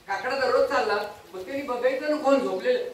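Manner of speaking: ordinary talk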